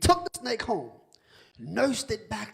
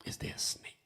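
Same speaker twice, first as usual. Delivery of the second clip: hushed; 10 dB quieter than usual. Real, not acted. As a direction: drop to a breathy hush on snake. Preacher hushes on snake.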